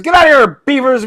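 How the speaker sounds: high-pitched, yelled order to leave; acted, high-voiced dismissal; bartender's shout. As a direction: holler it across the bar. Joke: bartender throws beavers out.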